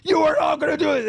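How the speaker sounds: Boogie screaming. Retold or imitated. Quoting a friend screaming over the phone, high mock scream. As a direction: hammer out a frantic, imitated scream down the line. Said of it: "screaming and fucking yelling".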